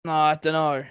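ordinary delivery

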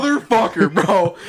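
retold cursing, cracking up; laughing outburst. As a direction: exclaim it in laughing disbelief. Retold reaction to stick snapping.

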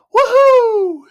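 very high, loud, drawn-out whoop; whooping "woohoo". Retold or imitated. The man's heard instruction from God, whooping cheer. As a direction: let out a soaring whoop. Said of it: "and go".

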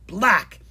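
loud, high noise of disgust; spitting-out "blech". A narrator's reading. Dragon spitting out mustard pine cone, disgusted. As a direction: blurt a "blech" of revulsion.

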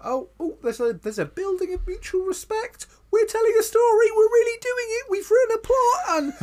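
very high, loud, crying gush; hammed-up tearful squeal of pride; mock gushing. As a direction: squeal it like an overjoyed writer. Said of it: "really just gently be like".

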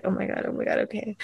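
calm yourself down quietly; flustered under breath. Retold or imitated. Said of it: "I was just like".

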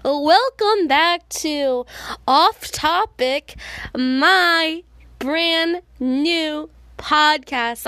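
drawn-out, loud, high podcast intro; show-opening announcer. Acted. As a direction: announce the show big, stretching the words.

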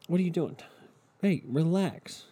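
high-pitched fussing at a coughing dog; calming the dog. Real, not acted. Breaks off to calm coughing dog.

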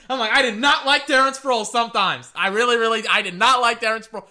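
half-laughing, punch the word not; giddy emphatic shout. Real, not acted. Laughing confession of dislike.

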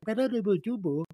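mock foreign-sounding chatter; unintelligible smart talk. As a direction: babble nonsense as if conversing learnedly. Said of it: "Are you guys speaking English?".

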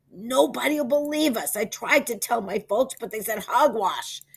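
a miserable boy's mock wail; hammed-up miserable wail. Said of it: "wailed Peter".

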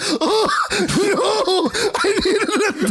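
high, laughing imitation of a friend; mock dismay, cracking up; laughing mimicry. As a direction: draw it out high through laughter.

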